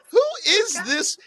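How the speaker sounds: who is this said high and slow in disbelief; mock bewildered. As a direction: sound baffled by a stranger. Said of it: "and I'm like".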